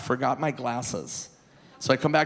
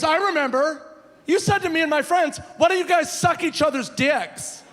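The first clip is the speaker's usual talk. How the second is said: mocking angry guy; 12 semitones higher, 4 dB louder than usual. Angry stranger repeats the insult.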